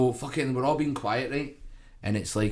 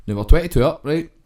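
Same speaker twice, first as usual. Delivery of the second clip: ordinary talk, nothing marked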